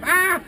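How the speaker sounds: short high scream; comic acted fright; panicked goblin yelp. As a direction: yelp as you glance back. Goblin screams again while running.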